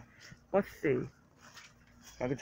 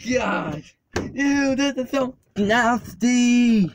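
slow drawn-out grossed-out ew, higher; disgusted; 6 semitones higher, 3 dB louder, much slower than usual. Real, not acted. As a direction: really savor how gross it is. Climbing into muddy truck.

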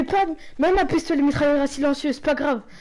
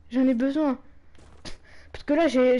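normal talking voice

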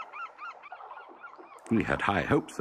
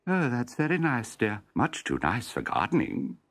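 lightly voiced wife; 6 semitones higher than usual.

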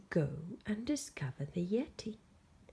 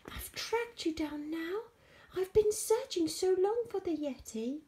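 put-on high, triumphant boy's voice; breathless with the find, pitched high; excited; 11 semitones higher, 4 dB louder than usual. George tells the monster his search is over, high and excited.

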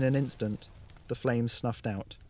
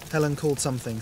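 ordinary delivery, nothing marked

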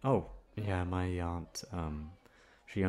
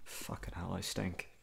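muttered aside; 6 dB quieter than usual. Acted. Muttering to himself, embarrassed.

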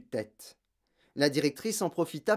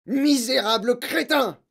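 loud, high, drawn-out insult; screamed contempt; furious yell; 9 semitones higher, 7 dB louder than usual. A narrator's reading. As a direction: yell it like a raging tyrant. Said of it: "hurla mademoiselle Legourdin".

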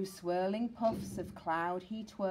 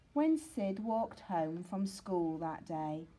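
ordinary delivery, nothing marked

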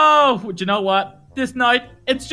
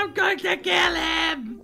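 slow, drawn-out strangled cry; exasperated wail; 4 semitones higher, 6 dB quieter, much slower than usual. Acted. Fooled dad rages about his son, exasperated wail. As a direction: howl it in hammed-up fury. Dad's outburst after being fooled.

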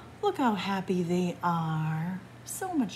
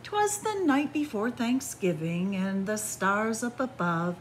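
put-on lilting rhymed read-aloud for children; storybook reading; 3 semitones higher than usual. A narrator's reading. Reads picture book aloud.

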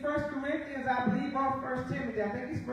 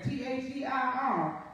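usual speaking voice

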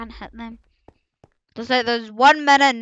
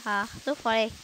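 ordinary talk, nothing marked

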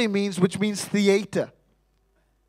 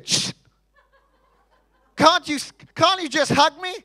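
higher pleading wife voice; mock tearful wife; 7 semitones higher than usual. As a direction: plead like a wife who wants a hug. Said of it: "when I say".

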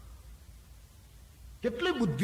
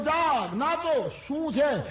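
hammed-up joy; 10 semitones higher, 6 dB louder, much slower than usual. Merchant pretends joy to thief.

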